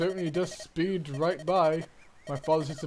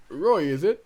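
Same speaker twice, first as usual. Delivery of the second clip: ordinary talk, nothing marked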